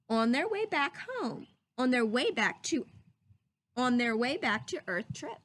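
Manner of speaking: put-on lively storybook reading for children; read-aloud voice. Teacher reading a story to her class, lively read-aloud. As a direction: read it like a picture book to the class.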